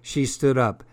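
ordinary delivery

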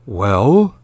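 lightly voiced deep monster dad; a hint of a growling father. A narrator's reading. Monster father answers his frightened child, lightly deepened voice. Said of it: "said the deep voice".

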